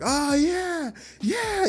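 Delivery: high-pitched crowd cheer; excited cheer. Acted. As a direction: cheer like a thrilled classroom. Class cheers becoming heroes.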